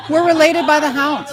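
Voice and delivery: 1 laughing. Real, not acted. Laughing joyful declaration.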